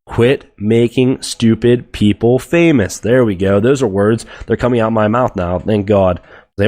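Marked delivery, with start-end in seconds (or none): 0.0-3.0 each word spaced out slowly and clearly; slow and deliberate. Shown as a demonstration. Host retrying his rant after tripping over words, slow and deliberate. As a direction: spell it out slowly so it finally lands. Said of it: "Let's say this one more time".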